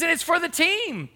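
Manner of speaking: high-pitched mock insistence; high retold comeback; imitated insistent wife. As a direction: add it emphatically as the exasperated wife.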